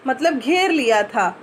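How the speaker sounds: ordinary delivery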